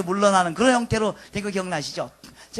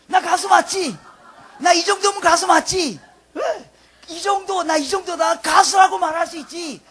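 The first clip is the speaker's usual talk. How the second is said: loud, high, insistent challenge; singer demanding recognition; 6 semitones higher, 7 dB louder, slower than usual. Retold or imitated. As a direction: act a singer calling out to prove themselves. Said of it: "그 사람들이 하는 메시지가 뭐예요". (인정해 달라고 크게 외치듯)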